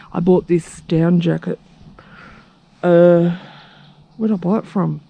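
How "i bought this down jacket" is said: normal talking voice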